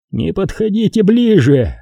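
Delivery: high-pitched, urgent warning from a villain; threatening villain. A narrator's reading. Xanatos to the Jedi, holding a hostage, threatening.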